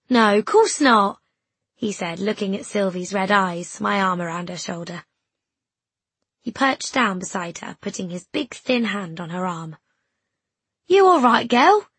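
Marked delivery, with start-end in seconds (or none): 0.1-1.2 loud, slow, lightly voiced lad; a hint of a boy, drawn out loudly; easygoing boy. Boy reassuring his two friends, easygoing. 10.9-11.9 high, loud lad's question; concerned boy. Acted. Boy checking on the tearful girl, concerned.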